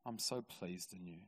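very soft, low and gentle; hushed, heartfelt voice of God; tender fatherly approval. Retold or imitated. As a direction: quietly reassure a beloved son. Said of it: "hear God say to me".

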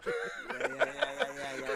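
laughing through words; giggly amused yeah. Real, not acted. Laughing at the joke.

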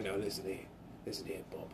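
soft low British warning; hushed action-hero warning; Statham impression. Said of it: "Sounds like Jason Statham".

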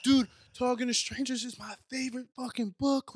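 high-pitched fanboy gushing; losing his mind. Retold or imitated. Fan telling Malcolm about his favorite book, losing his mind. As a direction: gush in a high voice, overwhelmed by your idol. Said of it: "I was losing my mind".

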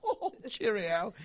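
high, drawn-out mock British farewell; hammed-up British cheeriness; British guests. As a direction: trill it like silly celebrities. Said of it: "and they all go".

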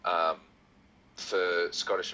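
ordinary delivery

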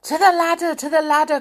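loud, urgent call to the ladder; crying out. Acted. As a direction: hurry everyone along. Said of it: "cried Moonface".

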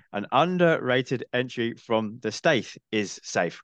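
each word drawn out deliberately; slow, careful retry. Real, not acted. Podcast host retrying a mouthful of a phrase, slow and careful. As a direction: pick slowly through the tongue-tying phrase.

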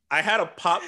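ordinary talk, nothing marked

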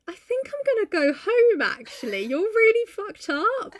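high, mock-nervous excuse; uneasy. Edgar deciding to go home, mock uneasy. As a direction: back out awkwardly, slowing at the end.

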